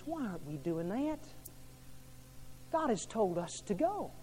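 ordinary talk, nothing marked

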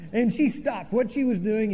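ordinary delivery

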